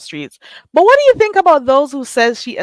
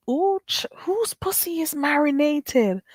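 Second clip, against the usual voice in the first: shocked hushed whisper; 7 semitones higher, 5 dB quieter than usual. Real, not acted. Host whispering over a shocking chat comment. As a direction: lean in and whisper the gossip.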